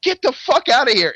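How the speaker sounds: acted-out incredulous urging, rising into a yell; urgent retold warning. Friend tells the fighter to flee the cops, urgently.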